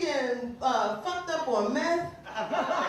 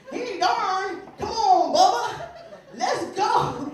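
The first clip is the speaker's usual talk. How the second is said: loud, high backwoods holler; rally your buddy like a swamp hillbilly; 7 semitones higher, 7 dB louder, slower than usual. Acted. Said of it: "You can get these hillbillies".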